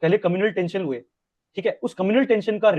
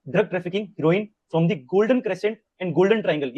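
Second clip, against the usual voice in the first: very slow, word by word, for notes; slow note-dictating read; slow dictation; 2 semitones higher, much slower than usual. Shown as a demonstration. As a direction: dictate so students can write.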